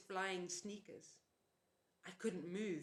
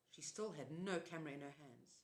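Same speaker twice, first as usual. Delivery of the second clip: ordinary delivery, nothing marked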